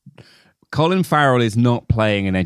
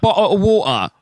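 higher, mock-English "Bottle of water"; lightly done English parody; stock English accent; 7 semitones higher than usual. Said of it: "Everyone goes".

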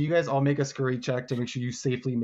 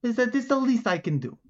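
higher-pitched gator character voice; grateful alligator; 11 semitones higher than usual. Acted. Alligator thanks helpers for their trouble, grateful and higher.

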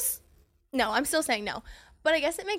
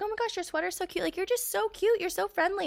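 put-on gushing older lady; 8 semitones higher than usual. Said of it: "one of the women, she's like".

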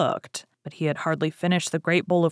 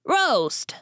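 startled echo; 8 semitones higher than usual. Acted. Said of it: "echoed the ogre's wife".

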